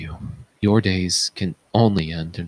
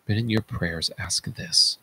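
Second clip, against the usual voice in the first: ordinary delivery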